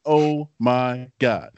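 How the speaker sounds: slow, drawn-out awe; awed reaction. Retold or imitated. Retelling his reaction to the burger, awestruck and slow. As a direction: stretch each word out, savouring it. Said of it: "my first three words were".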